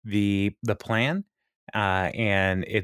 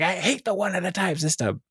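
mock yell; 9 semitones higher than usual. Townsman Mel objecting to one at a time, high mock yell. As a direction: imitate a habitual yeller with a much higher voice. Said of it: "who yells at everything".

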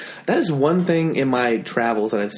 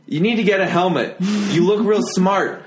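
loud scolding stranger, slowed; mock yell; 6 semitones higher, 3 dB louder than usual. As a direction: call out to a cyclist mockingly. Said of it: "someone said".

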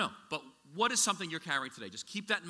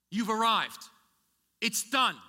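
louder, higher ringing proclamation; triumphant exclamation; 6 semitones higher, 6 dB louder, slower than usual. Real, not acted. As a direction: proclaim the arrival with joy. Preacher's climax.

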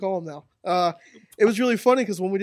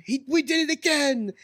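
imitating a scream; 8 semitones higher than usual. Retelling a tag partner's botched promo, mock scream. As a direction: imitate his screamed promo line, pitched up. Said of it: "he just started screaming".

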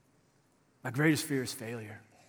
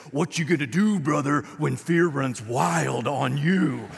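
showboating pro-wrestler growl, drawn-out ending; Hulk Hogan; 3 dB louder, slower than usual. Acted. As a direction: do a hyped wrestling catchphrase. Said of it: "in a Hulk Hogan vo-, voice".

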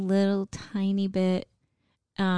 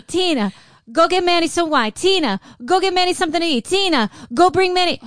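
higher, louder bossy orders; bossy father; 8 semitones higher, 7 dB louder than usual. Retold or imitated. Mimicking her father ordering her to serve, bossy. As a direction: bark out errands at Tina. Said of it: "it's gonna be".

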